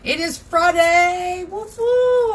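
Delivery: sing-song, slow "It is Friday" into a high, loud woo-hoo; a playful sung chant that breaks into a loud high cheer; excited Friday cheer. Real, not acted. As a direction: celebrate Friday like a little party. Celebrating Friday to viewers.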